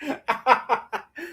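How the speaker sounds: breathless high cackle; high wheezing laugh. Real, not acted. Roasting fans, breathless high laugh. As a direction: let the laugh run out.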